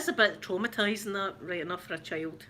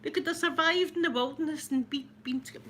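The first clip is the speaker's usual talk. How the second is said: high, breathless wishful gush; dramatic wish; 6 semitones higher than usual. Retold or imitated. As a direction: gush it like a heartbroken fan. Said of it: "I thought".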